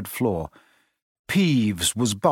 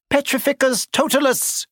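very high-pitched incantation; cried out; 14 semitones higher than usual. A narrator's reading. Hermione casts a spell on Neville, crying out. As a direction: cry the spell out. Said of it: "she cried".

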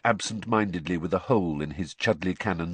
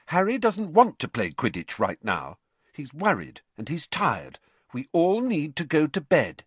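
reproving; 8 semitones higher, 4 dB louder than usual. Acted. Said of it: "I don't think you're being very sensitive sort of voice".

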